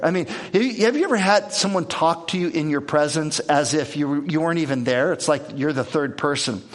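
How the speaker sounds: ordinary talk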